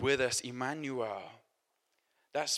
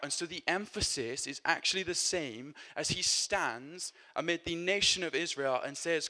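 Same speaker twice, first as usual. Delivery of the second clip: ordinary talk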